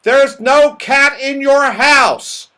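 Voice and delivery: loud, high, slow shout. Acted. Moonface correcting the deaf saucepan man, shouting slowly. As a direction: shout it slowly for someone who mishears. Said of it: "shouted Moonface".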